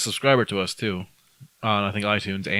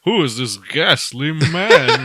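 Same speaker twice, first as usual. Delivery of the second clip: slow, loud, higher posh aristocrat voice; snooty aristocrat; 6 semitones higher, 7 dB louder, much slower than usual. Acted. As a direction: sneer it like a monocled snob. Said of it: "is it holding my monocle".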